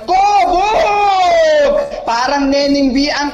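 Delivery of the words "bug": sudden shout. Real, not acted. Gamer shouting at opponent.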